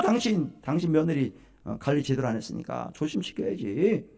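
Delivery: normal talking voice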